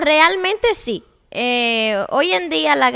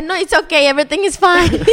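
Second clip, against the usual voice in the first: mock English; 3 semitones higher, 4 dB louder than usual. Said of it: "y tú dices".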